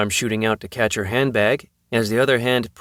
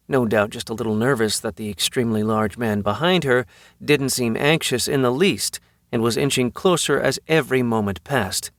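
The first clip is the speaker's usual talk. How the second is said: plain reading voice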